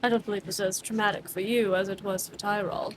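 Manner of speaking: low, quiet, firm questioning; lightly voiced calm stern interrogator. Arminius questioning Cyrus, quietly firm. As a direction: press for answers without raising the voice.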